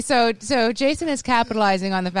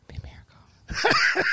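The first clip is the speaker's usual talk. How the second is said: breathy joking whisper; 4 dB quieter, slower than usual. Real, not acted. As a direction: whisper it like a secret hope. Joking whisper about house sale.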